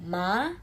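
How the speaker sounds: MA going directly upward; "ma" with a quick upward climb; rising second tone. Shown as a demonstration. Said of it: "naik langsung".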